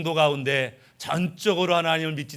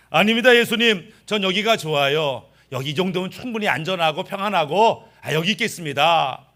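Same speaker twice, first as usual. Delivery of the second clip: stubborn refusal; 2 semitones higher, 4 dB louder than usual. Acted. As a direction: dig in your heels and refuse Jesus out loud. Refusing Jesus from fence. (예수님을 거절하며 높고 크게 버티는 목소리)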